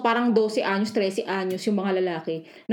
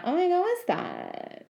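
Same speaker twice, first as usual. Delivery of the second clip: put-on valley girl voice; 8 semitones higher, 3 dB quieter than usual. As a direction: play a shocked teen. Mocking Gen Z reaction.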